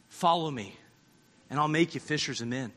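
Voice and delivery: low, hushed call to follow; quiet, tender calling; soft invitation. Acted. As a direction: invite him gently. Jesus' call voiced softly.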